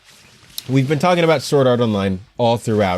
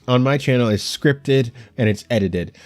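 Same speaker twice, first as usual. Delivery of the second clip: normal talking voice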